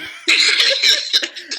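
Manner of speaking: high breathy laugh; wheezing laugh; burst of laughter. Real, not acted. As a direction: crack up at your own joke. Laughing at own impression.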